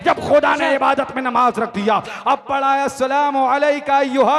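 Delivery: loud, high-energy sermon delivery; impassioned rhythmic declamation; full-force preaching. A narrator's reading. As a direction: hammer each phrase out to a large crowd.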